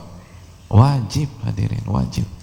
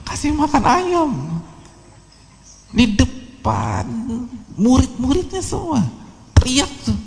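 high mocking voice; 4 dB quieter than usual. Joking about the mother's yelling.

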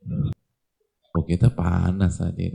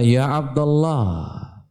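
slow, drawn-out, higher call; respectful address; 6 semitones higher, much slower than usual. Retold or imitated. The master addresses the worker slowly and respectfully. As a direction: honour him as a servant of God.

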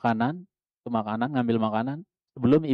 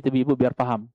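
usual speaking voice

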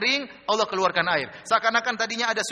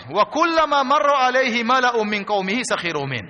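raised, ringing recitation; forceful scriptural recital; Quran recitation; 5 semitones higher, 4 dB louder than usual. Acted. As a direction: recite the verse loudly and high.

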